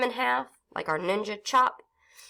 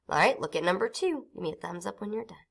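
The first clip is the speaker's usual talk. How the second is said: ordinary talk, nothing marked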